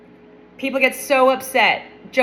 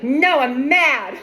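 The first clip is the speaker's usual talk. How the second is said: slow, louder, higher sulky whine; pouty mad; 4 semitones higher, 4 dB louder, much slower than usual. Pretend. Pouting Jonah refuses God, slow sulky whine. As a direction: drag it out like a grumpy kid.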